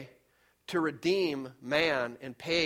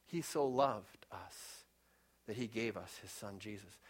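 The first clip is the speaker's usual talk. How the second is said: hushed and slow; 8 semitones lower, 12 dB quieter than usual. Real, not acted. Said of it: "verse said softly".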